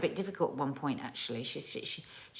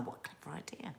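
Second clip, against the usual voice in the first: quiet admiring whisper; under-the-breath aside; 17 dB quieter, faster than usual. Real, not acted. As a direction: whisper it to yourself. Aside while reading comments.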